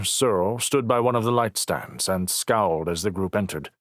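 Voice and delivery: ordinary delivery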